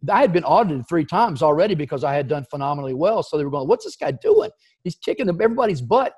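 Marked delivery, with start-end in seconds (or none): 3.7-6.1 high incredulous auditor voice; mock puzzled auditors. Auditors wondering aloud, high and incredulous. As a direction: play the baffled auditors, pitched way up.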